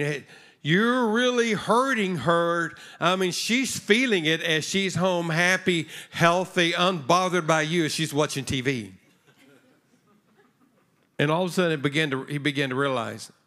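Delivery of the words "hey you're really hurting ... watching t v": louder, higher mock-sympathetic sarcasm; sarcastic emphasis. Real, not acted. Pastor sarcastically telling the man he is really hurting his mom. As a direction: needle him with exaggerated concern.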